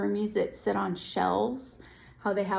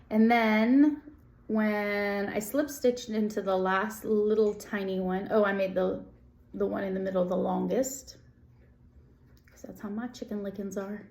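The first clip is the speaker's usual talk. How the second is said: usual speaking voice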